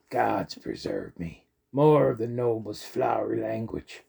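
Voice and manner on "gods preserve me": usual speaking voice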